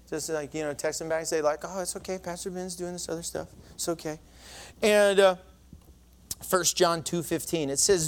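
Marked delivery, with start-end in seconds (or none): 0.1-4.2 much softer, low-key murmur; quiet aside. Real, not acted. Pastor dictating a text reply mid-sermon, softly offhand.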